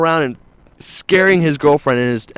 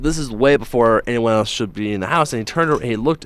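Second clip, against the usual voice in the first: ordinary talk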